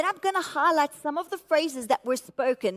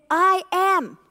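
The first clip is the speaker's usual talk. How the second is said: "I am" said firmly and a little louder; emphatic I am; 4 semitones higher, 4 dB louder than usual. Shown as a demonstration. Pastor stressing Jesus' words to the church, emphatic. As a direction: land "I am" hard, like a forceful claim. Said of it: "It's an emphatic statement".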